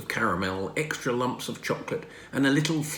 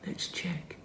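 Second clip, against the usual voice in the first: soft hushed whisper; father whispering to himself; 6 semitones higher, 9 dB quieter than usual. Acted. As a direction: whisper it as you reach for the phone. Father studies suspicious card.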